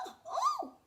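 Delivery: very high fluttery squeak; startled flamingo. Acted. Flamingo startled by crocodile, very high and flustered. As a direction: squeak in alarm. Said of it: "said the flamingo".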